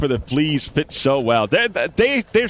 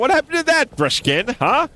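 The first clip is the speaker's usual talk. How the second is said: indignant; 11 semitones higher than usual. Real, not acted. Player scolding Brushkin over the refill price, mock-indignant. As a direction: call Brushkin out, playfully indignant. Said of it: "he said never to worry".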